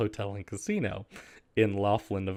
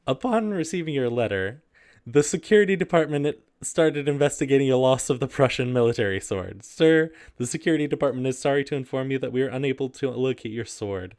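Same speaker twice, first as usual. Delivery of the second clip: higher, mock-formal letter reading; raised, overly proper read-aloud voice; 4 semitones higher, 5 dB louder than usual. Reads hotel's reply letter.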